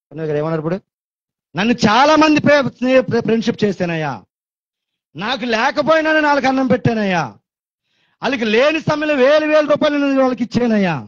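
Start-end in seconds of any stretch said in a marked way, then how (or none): 1.5-10.9 pleading complaint. Acted. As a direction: cry out that you gave everything. Preacher voices a betrayed friend.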